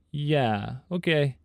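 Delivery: flat, awkward "yeah, okay"; awkward dismissive reply. Retold or imitated. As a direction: brush it off, unsure. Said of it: "He answered back and said".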